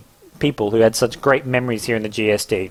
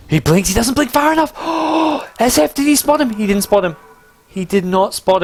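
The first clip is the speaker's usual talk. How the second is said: excited; 11 semitones higher than usual. Real, not acted. Tense play-by-play moment.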